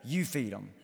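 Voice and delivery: low, soft weight on you; quiet solemn echo; hushed repeat. Shown as a demonstration. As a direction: drop it to a murmur, letting it land. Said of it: "Impossible task given".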